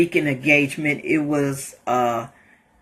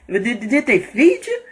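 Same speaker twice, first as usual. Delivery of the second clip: voicing the truck driver; 6 semitones higher than usual. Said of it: "He was like".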